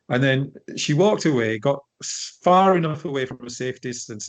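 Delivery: ordinary delivery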